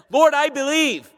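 "Lord, I believe" as a high, firm exclamation; emphatic. Shown as a demonstration. Father answers Jesus, emphatic "Lord, I believe" pitched high. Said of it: "The first is emphatic. It's like this".

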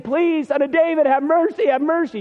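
high imitated plea, mildly tearful; desperate pleading. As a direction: plead like she's at his feet. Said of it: "She's hysterical. She's crying".

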